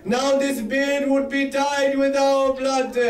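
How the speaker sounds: high, drawn-out declaration; impassioned vow. Acted. Vow repeated for emphasis.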